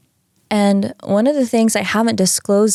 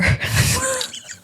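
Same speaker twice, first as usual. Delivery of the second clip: high laugh; 3 dB quieter than usual. Real, not acted. Laughs at Earth being severe.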